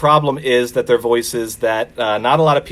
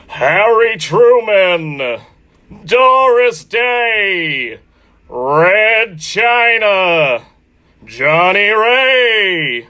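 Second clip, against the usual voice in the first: much higher, louder, very slow booming announcer; Don Pardo announcer voice; 8 semitones higher, 4 dB louder, much slower than usual. Pretend. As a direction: stretch every name out like a game-show announcer. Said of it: "Don Pardo had to step in for him".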